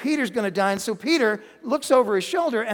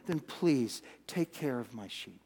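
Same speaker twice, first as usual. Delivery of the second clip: soft, slow and much lower, a gentle request; Jesus gently entrusting his sheep to Peter; tender plea; 9 semitones lower, 12 dB quieter than usual. Acted. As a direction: ask it tenderly, almost under your breath.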